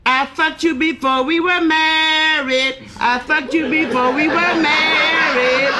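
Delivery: high, drawn-out sing-out holler. Acted. Husband hollers up to his wife from downstairs, hollering. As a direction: call it slowly up to an upstairs window. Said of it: "he hollers up to her".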